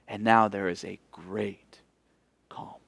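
quiet awe; whispered narration. A narrator's reading. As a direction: hush as the storm stops. Hushed storytelling of calm.

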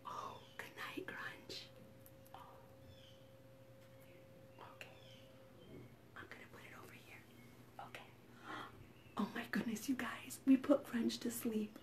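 very soft whispering; secretive, barely voiced hush. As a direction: whisper so the sleeper doesn't wake. Avoiding waking the sleeping dinosaur.

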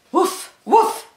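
high, loud, short woofs; two sharp storybook barks; dog bark. A narrator's reading. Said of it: "barked the dog".

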